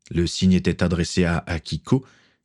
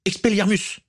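much higher yelled incantation; young wizard shouts a spell at the captain; shouted spell; 14 semitones higher than usual. Acted. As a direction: fling the spell with a cry. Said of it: "cria-t-il".

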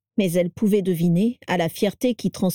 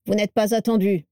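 coldly. A narrator's reading. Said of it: "dit-il froidement".

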